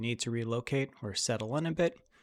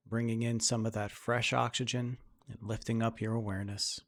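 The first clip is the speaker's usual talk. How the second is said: guided meditation; 3 dB quieter than usual. Acted. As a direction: ease them into calm breathing, unhurried. Leads a guided breathing meditation.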